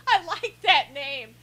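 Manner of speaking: slow, laugh-filled delight; laugh, then talk through it; tickled, drawn out with laughter. Real, not acted. Amused by a chat name.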